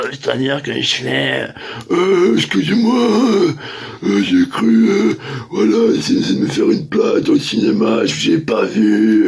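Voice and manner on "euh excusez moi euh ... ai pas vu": higher, louder put-on voice of a fake apology; mock apology. [name] acts his fake excuse to the woman, mock innocent. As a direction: play innocent, a bit loud and high.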